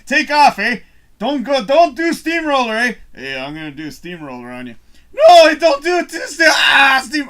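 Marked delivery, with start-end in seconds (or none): 0.1-2.9 a hint of a Canadian hoser accent, loud; loud, high Canadian hoser banter; Bob and Doug voice. Man doing Bob and Doug banter, Canadian accent, loud. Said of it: "Bob and Doug". 5.1-7.3 loud, high wailing cry; mock crying plea. Man playing the victim of the steamroller, crying out loud.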